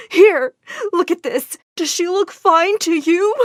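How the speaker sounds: high, emotional challenge; hurt challenge. A narrator's reading. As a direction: thrust the proof at her, wounded. Hurt, shows proof of sick mother.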